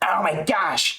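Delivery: high, excited commentator gasp; Joe Rogan impression. Fan imitating Rogan gushing over the fight, high and amazed. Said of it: "if Rogan was watching this card".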